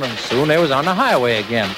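ordinary delivery, nothing marked

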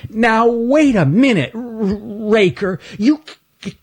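high, stammering protest; a small critter bravely objecting; Stanley's stutter. Acted. Stanley protests to Raker, stammering high.

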